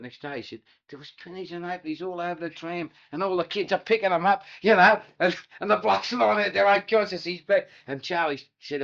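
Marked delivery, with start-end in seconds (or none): 0.9-7.7 building excitement. Retold or imitated. Excited climax of fight story.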